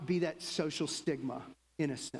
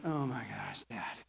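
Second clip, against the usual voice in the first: exasperated; 7 semitones lower, 4 dB quieter than usual. Retold or imitated. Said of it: "my daughter's just like".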